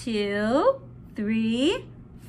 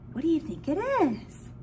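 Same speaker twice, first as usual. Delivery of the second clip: usual speaking voice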